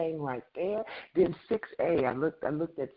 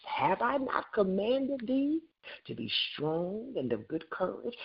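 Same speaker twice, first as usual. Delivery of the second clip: preaching God's command; 6 semitones higher than usual. Acted. God's voice calling Joshua, preaching.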